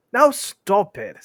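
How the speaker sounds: high, loud, drawn-out order; loud, slow protest; exasperated troll shout. Acted. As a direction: stretch it out and shout it. Two trolls shout at William.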